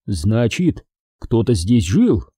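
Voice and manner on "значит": usual speaking voice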